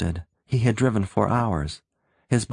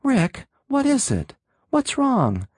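bewildered woman; 14 semitones higher than usual. A narrator's reading. Sylvia pleads bewildered.

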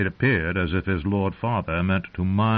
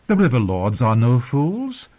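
raised, insistent queenly voice; arguing; 5 semitones higher, 5 dB louder than usual. A narrator's reading. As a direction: argue back, higher and louder. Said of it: "the Queen argued".

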